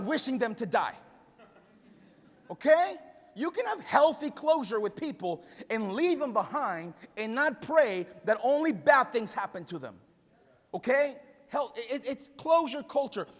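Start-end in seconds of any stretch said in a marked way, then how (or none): none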